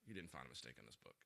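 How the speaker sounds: dad quietly doubting his son inwardly; inner thought. Retold or imitated. Dad doubting his son's claim, thinking it quietly to himself. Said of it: "And I'm thinking to myself".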